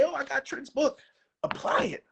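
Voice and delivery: ordinary talk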